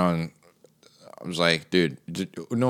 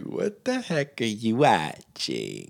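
slow, drawn-out baffled question; mock disbelief; 2 semitones higher, 4 dB quieter, slower than usual. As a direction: stretch it out in exaggerated bewilderment. Mock reaction to the show.

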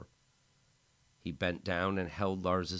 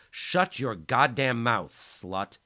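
louder, slow, menacing order; a higher, louder slow threat; angry command; 6 semitones higher, 8 dB louder, slower than usual. Acted. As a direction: slap him down with words. Lance slaps, snarls.

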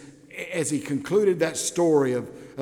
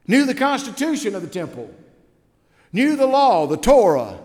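mock boasting; 7 semitones higher, 6 dB louder than usual. Mocking the Pharisee's credentials.

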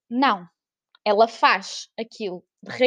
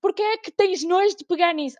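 higher, louder mock chef interrogation; mock Gordon Ramsay; 8 semitones higher, 4 dB louder than usual. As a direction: grill the contestant like a furious TV chef. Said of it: "ele é Gordon Ramsay". (mãe como Gordon Ramsay, a interrogar em tom agudo e zangado)

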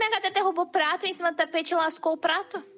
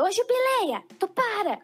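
gruff scolding; 8 semitones higher, slower than usual. Shown as a demonstration. Owner scolding her cat Jubileia, gruff scolding tone. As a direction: snap at the cat to stop. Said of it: "se eu falo um pouco mais grosso, tipo, brigando".

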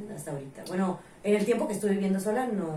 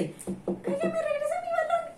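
put-on high, squeaky pleading kid voice; child asking for ball. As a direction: put on a little kid's high voice.

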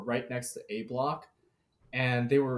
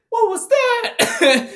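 much higher, louder and very slow, through laughter; laughing quote; 11 dB louder, much slower than usual. Retold or imitated. Startled kid asking about the air horn, laughing. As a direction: crack up while quoting the startled kid. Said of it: "the kid's saying".

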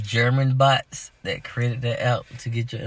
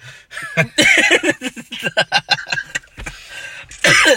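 loud high laugh; 8 dB louder than usual. Real, not acted. Joke about an old person's photo.

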